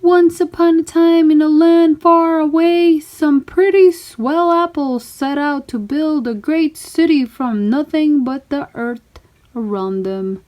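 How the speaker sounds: high, loud once-upon-a-time storyteller; storytelling voice. Acted. Mac begins telling the friends a story, grand storyteller voice. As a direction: launch into the tale with big fairy-tale flair. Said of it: "So Mac began".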